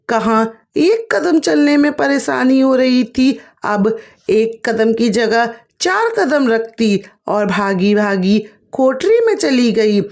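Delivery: plain reading voice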